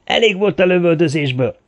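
high, insistent telling-off; shouted reprimand. A narrator's reading. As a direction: yell at him to stop. Comrade shouts to stop the shooting. (emelt hangon rászólva)

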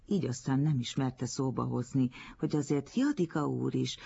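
plain reading voice

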